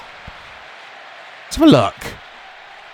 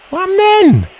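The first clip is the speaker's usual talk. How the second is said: much higher and louder, baffled; surprised outburst; 4 dB louder than usual. Real, not acted. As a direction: blurt it out, caught off guard. Sudden confusing play in game.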